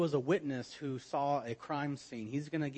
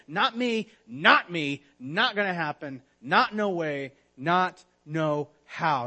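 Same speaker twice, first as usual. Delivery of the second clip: mock-indignant Peter insisting he never will; insistent Peter; 3 semitones higher, 7 dB louder than usual. Peter swears to Jesus he never will, in loud insistent protest.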